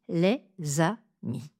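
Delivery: les amis, le Z porté sur amis et la fin plus basse; liaison correcte. Shown as a demonstration. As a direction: dites lé-za-mis. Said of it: "Je dis". (liaison correcte dans les amis)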